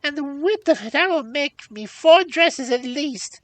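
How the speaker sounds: very high, loud crone exclamations; exclaiming old hag. A narrator's reading. Old hag seizing the rich robe, exclaiming. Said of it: "with many exclamations".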